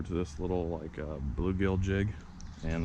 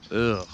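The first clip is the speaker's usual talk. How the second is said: annoyed ugh; 6 dB louder than usual. Real, not acted. Handling a sick skinny fish.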